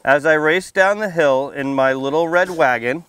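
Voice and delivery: put-on lively storybook read-aloud for little kids; reading to children. A narrator's reading. Firefighter reading a picture book to kids at story time, lively read-aloud. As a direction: read it out bright and clear for the children.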